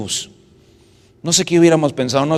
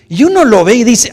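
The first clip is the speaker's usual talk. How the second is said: usual speaking voice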